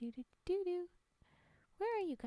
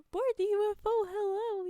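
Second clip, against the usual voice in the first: announcer call; 6 semitones higher, 7 dB louder than usual. Acted. Calling viewers to the UFO.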